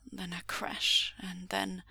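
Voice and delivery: plain reading voice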